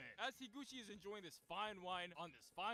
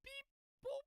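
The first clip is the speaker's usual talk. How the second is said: very high beep boop; high robotic beeps; beeping keypad noise. Retold or imitated. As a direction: chirp it like a machine. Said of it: "going beep".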